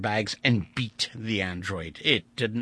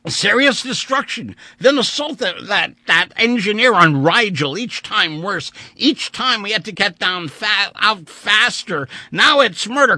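anguished rant; 11 semitones higher, 9 dB louder than usual. A narrator's reading. Vandeleur's rising anguished rant.

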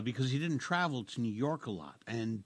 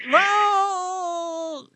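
loud cry; 13 dB louder than usual. Real, not acted. Shocked exclamation at the question.

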